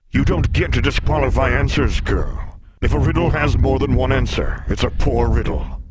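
loud, high-pitched monster outburst; indignant beast, booming; threatening sphinx. Acted. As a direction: loom over the girl and bellow.

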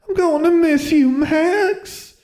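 very high, loud tearful wail. A narrator's reading. Sister seeing her brother off to school, loud high wail. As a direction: wail it out as she clings to him. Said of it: "Maximilian's sister wailed".

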